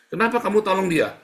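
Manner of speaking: high, loud demanding why; pointed question. Acted. Preacher playing an interrogator, loud and high. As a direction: press him hard for an answer.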